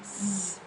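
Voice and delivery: a soft buzzing Z alone; buzzed z sound. Shown as a demonstration. Student demoing the ending sound for homework, soft buzzed Z. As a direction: hum the Z quietly. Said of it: "đọc là Z".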